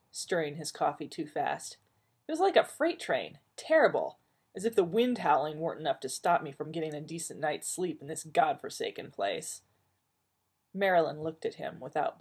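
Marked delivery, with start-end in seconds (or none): 2.3-9.6 Joel complaining. Acted. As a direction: grumble like a sleepless fussbudget. Joel complains about noise.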